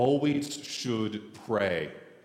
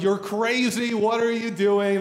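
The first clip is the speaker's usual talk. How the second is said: imitated incredulous crowd, pitched up; mock disbelief; 7 semitones higher, 4 dB louder than usual. Other runners laughing off the old farmer, mock disbelief. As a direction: jeer in disbelief, raised voice.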